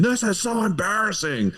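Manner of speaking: high, pained mock mortification; Billy Eichner sommelier. Quoting a sitcom sommelier to his co-host, high and pained. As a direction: squeal it like a mortified snob. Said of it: "you can hear him in the background saying".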